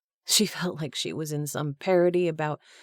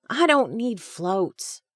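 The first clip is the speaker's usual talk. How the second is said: put-on a child's indignant protest, higher and slow; indignant boy; 7 semitones higher, 3 dB louder, slower than usual. A narrator's reading. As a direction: pout and insist like a stubborn kid. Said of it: "Noah said indignantly".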